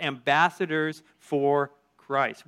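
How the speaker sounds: each word drawn out slowly; preacher spelling out the verse; slow emphatic reading. Acted. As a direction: lean on it, slowly, like a key line of scripture. Preacher reading key verse phrase.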